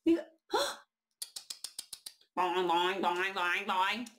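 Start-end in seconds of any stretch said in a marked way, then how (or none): 2.4-4.1 playful boing sounds; bouncy boing. Acted. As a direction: bounce the words like a rabbit. Imitating a bouncing rabbit.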